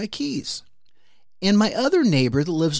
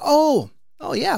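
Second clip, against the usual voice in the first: high, louder sheepish oh; mock sudden realisation; 11 semitones higher, 5 dB louder than usual. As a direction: yelp it like you just remembered.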